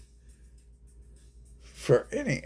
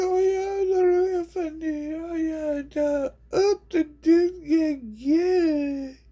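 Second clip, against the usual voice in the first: very high squeaky whine, louder; mocking falsetto; 8 dB louder than usual. People mocking the clown act, squeaky high falsetto. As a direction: sneer it in a shrill cartoon squeal. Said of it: "And they be like".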